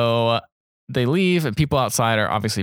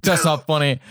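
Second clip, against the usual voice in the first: higher, mock-flustered protest; lightly acted freak-out; imitating freaked-out man; 5 semitones higher than usual. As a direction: protest twice as if rattled. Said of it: "just got freaked the fuck out".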